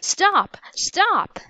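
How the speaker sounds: high-pitched calls without much volume; two high, contained cries; urgent cry. A narrator's reading. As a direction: cry out high to halt someone. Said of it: "cried Jack".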